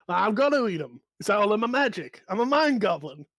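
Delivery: high, loud smug declaration; proud goblin. Acted. Goblin boasting to the player about eating books, high and loud. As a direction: announce it like a proud little pest. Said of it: "looks up to you and says".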